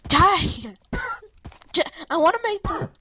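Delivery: loud high battle cry; shouted. Real, not acted. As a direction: yell it as you strike. Attacking a mob in the game.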